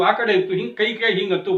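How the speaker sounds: ordinary delivery, nothing marked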